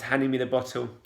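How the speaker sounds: ordinary delivery, nothing marked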